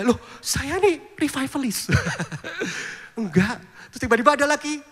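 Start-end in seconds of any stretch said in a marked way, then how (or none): 0.0-1.9 a touch of laughing, startled indignation; higher, slow, half-laughing protest. Retold or imitated. Pastor retelling his startled reply to a churchgoer, laughing. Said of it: "Kaget saya".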